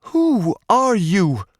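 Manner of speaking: Paige's high slow demand. A narrator's reading. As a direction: demand an answer. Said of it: "Paige demanded to know".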